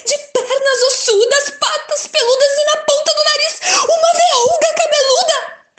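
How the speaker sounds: high, loud, laughing description of the monster; excited storytelling. A narrator's reading. Narrator thrilling the children, high and laughing. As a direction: pile up the scary details with glee.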